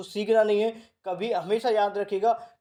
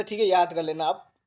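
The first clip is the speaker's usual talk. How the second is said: usual speaking voice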